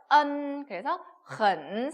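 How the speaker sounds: loud 언, then slow 헌, both with uh vowel; drawn-out, emphatic 언 and 헌; correct pronunciation. Shown as a demonstration. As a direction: model 언 firmly, then add the h for 헌. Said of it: "어떻게 읽어요?".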